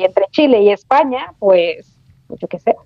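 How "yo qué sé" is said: usual speaking voice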